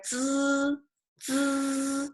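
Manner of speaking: "Zi" held long and high with a buzzed onset; closed-teeth zi. Shown as a demonstration. Teacher demonstrating teeth position to students, closed-teeth zi. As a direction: sustain "Zi" through touching teeth, perfectly level. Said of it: "touching each other".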